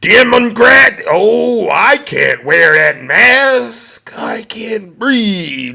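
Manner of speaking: drawn-out, slow whining complaint; mocking whiny shopper. Comedian mocking an anti-mask shopper, slow whine. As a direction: whine like a put-upon anti-masker.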